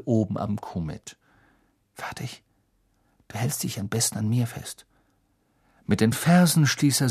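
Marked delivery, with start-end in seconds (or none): 1.9-4.9 hushed and gentle; quiet whisper. A narrator's reading. Harry whispers before flight.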